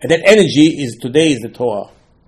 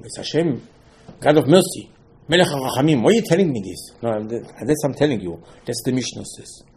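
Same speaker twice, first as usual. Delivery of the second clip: normal talking voice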